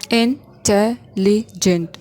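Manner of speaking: Intelligent drawn out slowly syllable by syllable; slow syllable count. Shown as a demonstration. Teacher counting syllables for students, slow and deliberate. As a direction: stretch out Intelligent so each syllable is heard. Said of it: "How many syllables are there".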